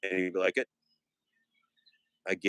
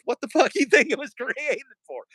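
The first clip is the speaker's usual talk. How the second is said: laughing outburst; 11 semitones higher, 5 dB louder than usual. Acted.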